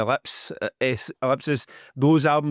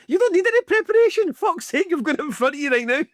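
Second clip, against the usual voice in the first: mock-outraged telling-off, pitched way up; exasperated. Real, not acted. Exasperated jab at co-host.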